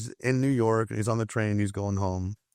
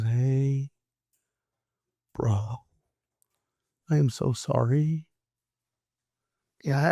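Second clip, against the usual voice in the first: subdued, halting imitation of a sorry man; imitated contrite, gentle offer of help; remorseful apology. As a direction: act out a sincere apology with long pauses. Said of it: "before he was filled with anger".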